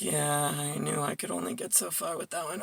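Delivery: a soft, dry retort under her breath; muttered retort. Retold or imitated. Retelling her reply to her father, quietly muttered. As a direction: say it low, like an aside nobody should hear. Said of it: "I'm like".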